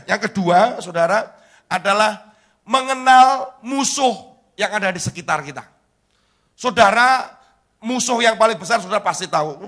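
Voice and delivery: loud, high-pitched sermon delivery; forceful preaching. Real, not acted. Pastor urging congregation to open spiritual eyes, forceful preaching.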